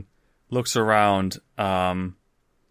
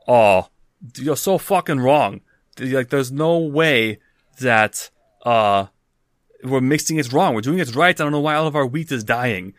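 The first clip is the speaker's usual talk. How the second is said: loud, higher-pitched argumentative retort; bickering; 4 semitones higher, 6 dB louder than usual. Acted. Farmer disputing the mix with his partner, bickering loudly. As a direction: argue back hard, voice lifted. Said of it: "bickering voices enter".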